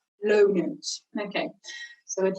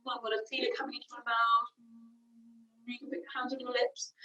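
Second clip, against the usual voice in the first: quiet coaching; 10 dB quieter than usual. Shown as a demonstration. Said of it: "quiet coaching during hum".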